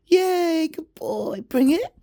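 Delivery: very high, loud squealing praise; thrilled cheering for the dog; excited praise. Real, not acted. Cheering her dog Pip during fetch, high excited squeal.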